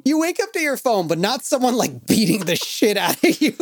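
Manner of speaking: high-pitched mock outrage at the phone; incredulous teasing. Real, not acted. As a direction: tease him in disbelief.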